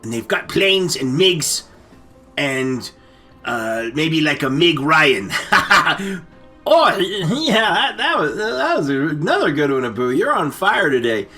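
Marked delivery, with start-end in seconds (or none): none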